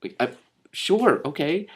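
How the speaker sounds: jumps high on sure; surprised high sure; startled sure. Real, not acted. Reader reacting to the magic wardrobe, startled.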